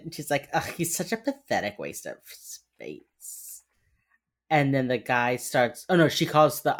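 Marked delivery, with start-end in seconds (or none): 0.5-3.6 low, slow, drawn-out disdain; mock disgust. Imitating an agent disparaging her son, mock-disgusted. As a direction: sneer it theatrically, stretching the last word. Acts out insult, later repeats plainly.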